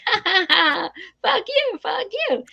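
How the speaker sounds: put-on high, giggly child voice; mock kid cackle, then high sing-out taunts; imitating Edward. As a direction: play a naughty kid delighted with himself. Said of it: "'cause then he's like".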